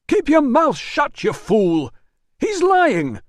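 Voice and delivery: loud, very high panicked yell; screaming. Acted. Manager leaps up screaming at his doorman, loud and high.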